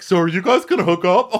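high, loud nosy teasing; mock teasing. Girl asking a couple about hooking up, mock teasing. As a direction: belt it out as a hammed-up tease.

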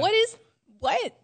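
high, loud burst of bafflement; baffled disbelief. Real, not acted. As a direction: blurt it out, totally thrown. Baffled by bubble and squeak.

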